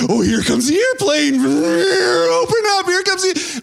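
high, loud sing-song coaxing; airplane spoon-feeding voice. Acted. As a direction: swoop up high like coaxing a baby to eat. Parent spoon-feeding a baby.

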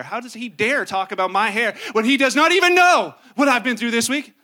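mock outrage building to a loud burst; rising into an offended shout; indignant rant.

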